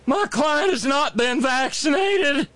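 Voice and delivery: hammed-up courtroom declaration; mock shout. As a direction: bellow it like a pompous lawyer. Mock lawyer pronouncement.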